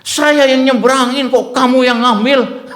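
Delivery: high, loud, drawn-out mock complaint; angry protest. Driver to rival who took his passengers, angry. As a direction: imitate an indignant driver accusing a rival. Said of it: "Marah dia".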